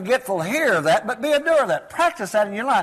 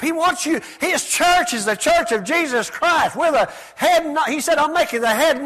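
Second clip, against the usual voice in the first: raised, emphatic preacher delivery; preaching; 5 semitones higher, 3 dB louder than usual. Acted. Preacher rallying the congregation, emphatic preaching.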